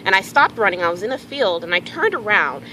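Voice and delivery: ordinary delivery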